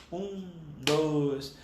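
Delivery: slow, drawn-out count; counting the beat. Shown as a demonstration. Music teacher showing students how to count time, slow counting. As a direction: count in a steady, slow tempo. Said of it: "você contar o tempo".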